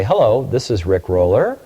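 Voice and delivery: "Rick Roller" swung up like a question; wrong questioning inflection. Shown as a demonstration. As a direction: introduce yourself as if asking, "Roller" rising. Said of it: "Watch your inflection".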